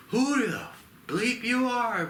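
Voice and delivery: high, slow squawk of an outraged ape; mock angry ape. Ape guard squawks at the stranger helping slaves, high and outraged. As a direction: squeak it out like a furious little thug. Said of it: "the other ape goes".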